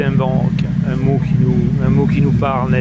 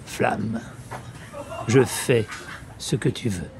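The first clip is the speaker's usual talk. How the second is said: solemn verse reading with long pauses between phrases; declaimed French poem, line endings falling away; poetry recitation; 4 dB quieter than usual. Acted. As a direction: recite it phrase by phrase, letting each image resonate. Recites Apollinaire's Le Brasier.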